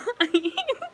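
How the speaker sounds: high amused laugh. Real, not acted. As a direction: laugh at your own bit. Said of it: "You know what I'm saying?".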